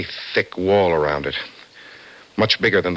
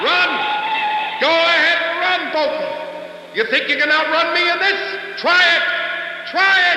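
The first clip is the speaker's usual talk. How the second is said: shouted taunt. Acted. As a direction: yell as you chase him down. Kingman taunts from racing car.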